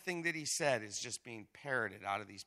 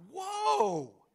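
much higher, stunned exclamation; whoa; 6 dB louder than usual. Real, not acted. Preacher reacting to Eliab's insult, high startled whoa. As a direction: gasp at how harsh that was. Reacting to the brother's harsh words.

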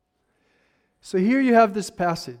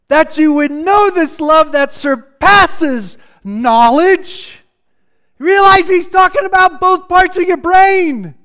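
preaching hot, higher and louder; really getting fired up, pushing it out louder; 6 semitones higher, 5 dB louder than usual. Real, not acted. Preacher fired up about the whole brain, rising excited preaching. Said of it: "I'm fired up a little bit".

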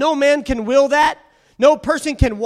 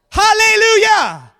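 shouted hallelujah; 8 semitones higher, 7 dB louder than usual. Real, not acted. Calling out to congregation.

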